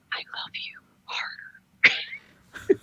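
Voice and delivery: slow drawn ASMR whisper. Retold or imitated. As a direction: breathe it out slowly. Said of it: "she said".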